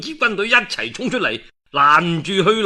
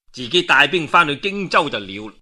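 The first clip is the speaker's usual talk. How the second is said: usual speaking voice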